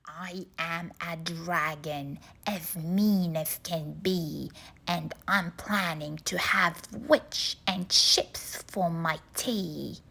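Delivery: low, menacing dragon growl; a mean dragon licking his lips; deep hungry dragon. A narrator's reading. Dragon voiced in story.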